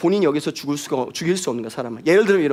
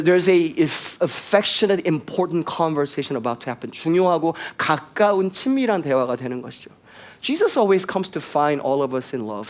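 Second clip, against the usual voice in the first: usual speaking voice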